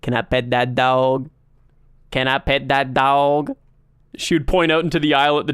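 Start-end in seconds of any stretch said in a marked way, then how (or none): 0.0-3.5 low, odd monotone mimicry; dead-eyed coworker imitation. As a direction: imitate a coworker staring you dead in the eyes. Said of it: "stared me dead in the eyes and started going".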